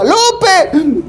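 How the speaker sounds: high shrill-free calling yell of a mother; mom calling out. Retold or imitated. Mother calling her kid home, high calling shout. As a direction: call the child from across the house. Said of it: "te gritaba".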